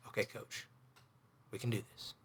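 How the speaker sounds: a private pep talk, barely voiced; hushed self-encouragement before the pitch; whispered self pep talk. Real, not acted. Psyching himself up, aside.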